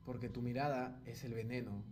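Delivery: hammed-up tender declaration to the girl on camera; mock romantic offering. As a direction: hush it like a cheesy proposal.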